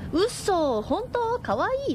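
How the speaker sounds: light, high mock gush of a trendy girl; a hint of an eighties girl squealing; gushing young woman. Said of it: "サンゴ族っていうのかな".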